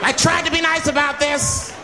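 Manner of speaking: high, exasperated outburst; flipping out. Acted. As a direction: play a man at the end of his patience. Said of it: "Now the guy flips out".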